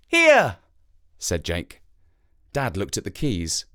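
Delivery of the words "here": put-on high boy's voice; a boy telling the crow about his dad, in a high child's voice; young boy. A narrator's reading. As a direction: speak like a small boy explaining.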